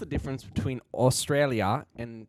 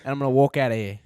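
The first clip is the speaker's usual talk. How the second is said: loud, higher mock British voice; British accent; 4 semitones higher, 6 dB louder than usual. Queen threatens a homeowner's rug, loud British accent. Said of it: "I lost my British accent".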